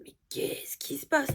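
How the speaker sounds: hushed, breathy whisper of worry; retold whisper. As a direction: whisper it as if unsure what's happening. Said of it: "J'étais en mode".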